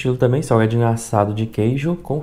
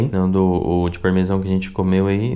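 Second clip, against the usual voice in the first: ordinary talk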